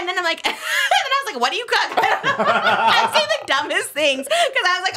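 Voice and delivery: high-pitched talk broken by real giggles; laughing. Real, not acted. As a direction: laugh helplessly at your own silliness. Laughing at own embarrassing questions.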